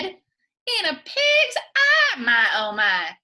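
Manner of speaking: slow high wail on pig's eye, shouted my oh my; a hammed-up granny wail; Granny's cry. Granny turning down the alligator with a loud mock wail.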